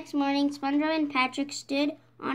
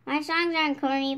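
ordinary delivery

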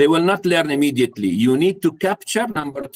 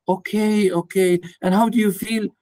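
caring parent; 5 semitones higher than usual. Retold or imitated. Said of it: "So you ask".

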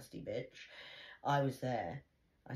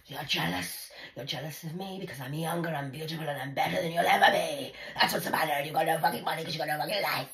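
louder, higher sneering daughter; imitating daughter's taunts; 3 semitones higher, 8 dB louder, faster than usual. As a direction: sneer out the insults.

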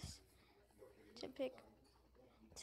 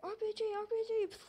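high, loud, fast alarm; urgent warning shout; 7 semitones higher, 6 dB louder, much faster than usual. Real, not acted. Warning of incoming rocket.

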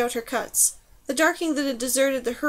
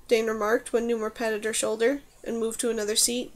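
usual speaking voice

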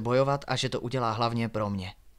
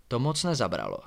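plain reading voice

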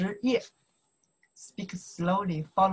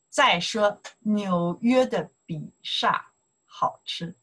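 slow model reading. Shown as a demonstration.

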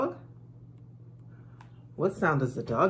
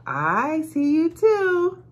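slow teacher voice; 13 semitones higher, 7 dB louder, slower than usual. Acted. Teacher looking back.

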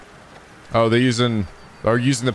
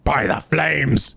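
dramatic fantasy oath; 3 semitones higher, much slower than usual. Retold or imitated. Echoes game character's oath.

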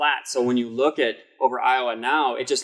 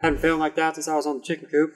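higher hammed-up Midwestern farm-boy; Midwestern accent; 4 semitones higher than usual. Co-host parodying how people mock Midwestern accents. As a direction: spin a yarn as a Midwest yokel. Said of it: "makes fun of accents like that".

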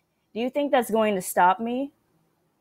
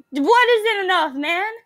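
very high, whining plea; panicked, high-pitched complaint; frightened protest; 9 semitones higher than usual. Real, not acted. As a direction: wail the question in distress. Frightened pleading with game character.

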